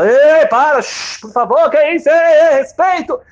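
high, loud hushing calls; guests calling out. Retold or imitated. Wedding guests calling for respect. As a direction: call out to stop the scene.

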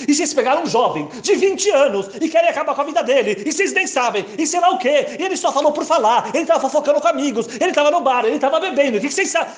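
high, loud, overacted courtroom plea; a young lawyer dramatizing for the jury; dramatic lawyer. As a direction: ham up the defence speech. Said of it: "começou a dramatizar".

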